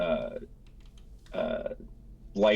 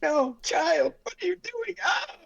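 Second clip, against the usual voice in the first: put-on very high, screaming old priest; terrified cry. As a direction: shriek for mercy under the blows. Priest beaten, crying out.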